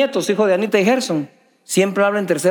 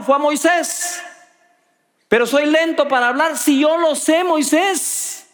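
higher, pressing preacher voice; fervent preaching; 7 semitones higher than usual. Acted. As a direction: preach it at the congregation. Preaching, building up.

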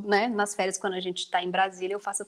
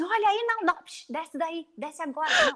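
put-on scolding kids; 7 semitones higher than usual. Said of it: "eu brigo também".

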